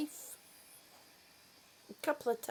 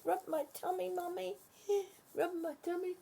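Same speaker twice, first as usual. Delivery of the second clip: higher pretend dog voice; voicing the dog; 6 semitones higher, 3 dB quieter, faster than usual. Mother voicing her dog begging for tummy rubs, childlike. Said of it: "she says".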